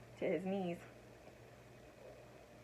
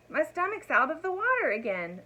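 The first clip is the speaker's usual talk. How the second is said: high, bright, cheery news; cheerful announcement; 7 semitones higher, 4 dB louder than usual. A narrator's reading. Dragon cheerfully telling Elmer the good news. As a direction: announce it happily. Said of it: "announced the dragon cheerfully".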